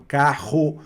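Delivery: slow "carro" with double R from the throat. Shown as a demonstration. Teacher likens French R to "carro", said with guttural RR. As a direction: stretch "carro", rasping its RR in the throat. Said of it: "na garganta, com o som do".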